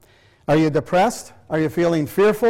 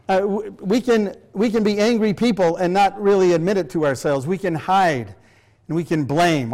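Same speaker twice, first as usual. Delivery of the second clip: ordinary talk, nothing marked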